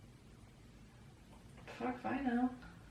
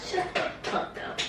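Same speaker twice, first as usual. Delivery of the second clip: whispered aside; 9 semitones higher, 6 dB quieter than usual. Real, not acted. Annoyed aside to the book.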